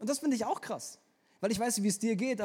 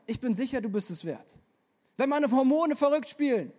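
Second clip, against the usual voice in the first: raised, louder preaching refrain; preaching at full force; 3 semitones higher, 4 dB louder than usual. Acted. Preacher assuring listeners of the Spirit's certainty, fervent. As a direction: hammer the refrain ich bin sicher.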